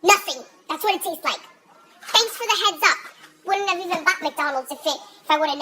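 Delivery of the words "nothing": louder emphatic repeat. Real, not acted. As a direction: punch it out loud.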